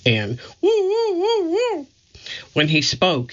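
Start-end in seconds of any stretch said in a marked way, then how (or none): 0.9-1.9 much higher, silly woo woo hooting; high woo hoots. Acted. Curly's funny sounds done as much higher silly hoots. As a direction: hoot like a goofy clown. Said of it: "He made funny sounds".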